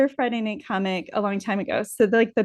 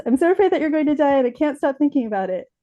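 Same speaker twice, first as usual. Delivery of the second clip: fast, higher, tumbling out; rushed confession; 6 semitones higher, faster than usual. Retold or imitated. Daughter blurts her fear to dad, rushed. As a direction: act out blurting it all at once. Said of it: "I got really out fast".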